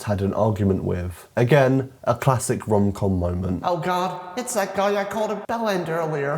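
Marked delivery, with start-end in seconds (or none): 3.6-6.4 high-pitched mock dismay; dismayed film heroine. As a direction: groan it in a put-on girlish voice. Acts heroine recognizing the new teacher.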